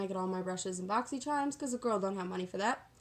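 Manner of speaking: ordinary delivery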